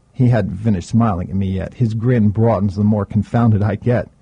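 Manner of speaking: plain reading voice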